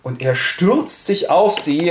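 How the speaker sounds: ordinary talk, nothing marked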